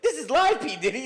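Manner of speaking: very high, loud frantic warning. Retold or imitated. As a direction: squeal that it's on air. Imitating [name] warning [name].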